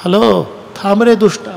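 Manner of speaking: high, soft, feeble hail; mock timid call. Shown as a demonstration. Preacher mocks a Jatayu who will not shout, feeble soft call. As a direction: call politely in a little voice that could never carry. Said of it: "आवाज तर पोहोचला पाहिजे".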